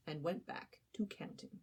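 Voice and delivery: plain reading voice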